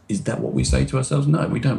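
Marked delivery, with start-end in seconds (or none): none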